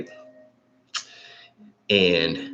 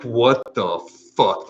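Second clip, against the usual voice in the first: higher, louder, slowed bewildered "What the fuck?"; 5 semitones higher, 5 dB louder, slower than usual. Retold or imitated. Waking mid-trip and not knowing where you are, higher and slow. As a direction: stretch out "What the fuck?" a bit high and loud in confusion. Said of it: "and it's like".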